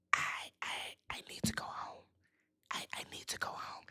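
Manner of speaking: breathy retold whisper, barely audible. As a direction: whisper it like a secret confession. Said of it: "she whispers to Patrick".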